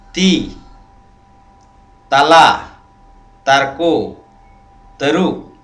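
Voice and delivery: each example word ᱛᱤ ᱛᱟᱞᱟ ᱛᱟᱨᱠᱳ ᱛᱟᱹᱨᱩᱵ punched out one by one; word list demo. Shown as a demonstration. Teacher reads example words to viewers, loud and separate. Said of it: "ᱡᱮᱞᱮᱠᱟ ᱯᱟᱹᱦᱤᱞ line ᱨᱮ ᱩᱫᱩᱜ ᱠᱟᱱᱟ".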